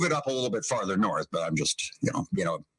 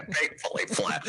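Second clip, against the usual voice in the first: laughing; 5 semitones higher, 4 dB quieter than usual. Real, not acted. Laughing, echoing cohost's quip.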